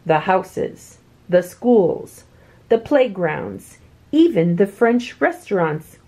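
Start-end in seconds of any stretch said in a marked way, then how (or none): none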